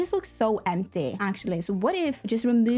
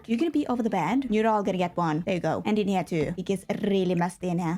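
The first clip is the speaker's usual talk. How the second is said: ordinary delivery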